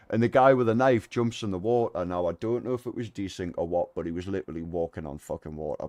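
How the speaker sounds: normal talking voice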